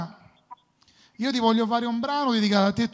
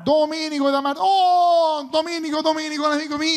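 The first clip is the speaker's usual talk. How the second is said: excited; 6 semitones higher than usual. Real, not acted. Host greets caller live on air.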